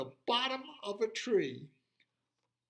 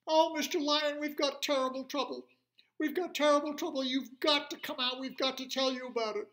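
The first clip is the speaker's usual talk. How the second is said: high, loud and hurried; worried little animals; 11 semitones higher, 8 dB louder, faster than usual. Acted. Little animals begging the lion to come out, high and urgent. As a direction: blurt the bad news in tiny voices. Frantic animals report trouble.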